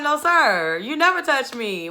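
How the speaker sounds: high, loud sassy denial; mock indignation. Woman answering whether she feels bombarded, mock indignant. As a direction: protest it in playful outrage.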